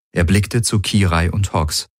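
usual speaking voice

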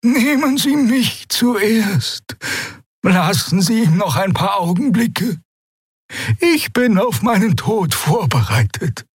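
put-on high, slow imploring old woman; pleading. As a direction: beg to die first.

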